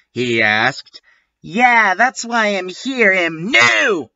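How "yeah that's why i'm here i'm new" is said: high-pitched, hammed-up cheer; happy. A narrator's reading. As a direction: chirp it happily, pitched up. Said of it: "I smelled happily".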